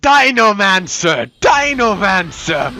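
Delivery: Dinomancer cried out with drawn-out syllables; mock battle cry, stretched out; imitating the game's shout. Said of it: "all we're hearing during that is".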